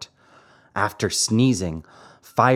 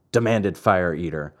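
usual speaking voice

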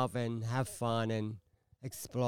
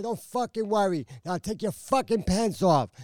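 raised, higher-pitched re-enacted order; retold angry command; 5 semitones higher, 5 dB louder than usual. Woman ordering her scared man to strip, retold angry command. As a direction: lay down the order hard.

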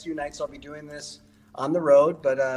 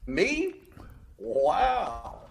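cowboy voice; 2 semitones higher, slower than usual. Pretend. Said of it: "Let's do improv".

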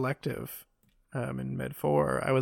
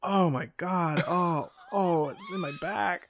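groaning through a back spasm; pained, strained moans; 3 semitones higher, slower than usual. Real, not acted. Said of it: "God, that hurts".

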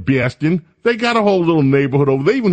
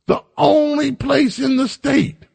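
incredulous; 12 semitones higher than usual. Real, not acted. Incredulous outrage.

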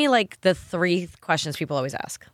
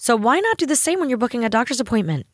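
upbeat sponsor read. Acted. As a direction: sell it to the listeners. Reads a sponsor ad.

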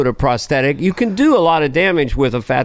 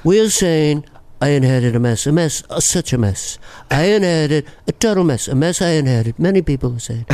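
flattering Trump mumble; Trump impression. Trump flattering the crowd, in his voice. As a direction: gush praise in a Trump voice. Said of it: "Trump is Brando and Regis".

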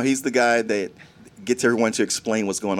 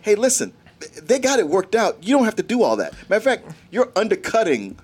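exasperated advice; 7 semitones higher than usual. Retold or imitated. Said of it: "It's like, it's like".

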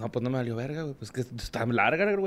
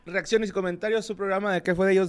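host intro; 6 semitones higher than usual. Acted. Podcast host opening welcome.

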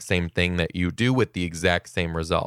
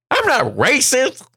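high, loud, slow protest; imitating a kid firing back indignantly; 6 dB louder than usual. Kid denying racism to classmate, high indignant protest. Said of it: "turned back and goes".

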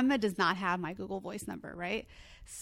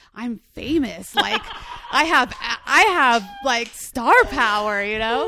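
slow, much louder laughing brag; laughing mock excitement; 3 semitones higher, 9 dB louder, slower than usual. Joking to her cohost about star power, laughing loudly. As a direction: ham up feeling famous through laughter. Retelling her own giddy reaction.

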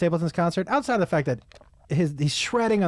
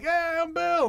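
high-pitched neighbor impression; Bill; 12 semitones higher, slower than usual. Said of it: "I also do my neighbor Bill".

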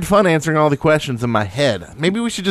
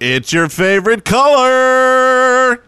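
much higher, slow, loud cartoon shout; silly cartoon shout; 9 semitones higher, 3 dB louder, much slower than usual. Host shouting in a goofy voice for player of the week.

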